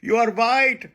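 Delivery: high, loud mock accusation; mocking his critics. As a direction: jeer it back like the taunting friends. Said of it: "telling me things like".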